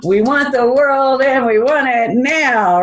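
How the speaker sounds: high, drawn-out mock demand; hammed-up impatient chant, slowly. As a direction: play at stamping for it now. Mocks own demanding journal entry.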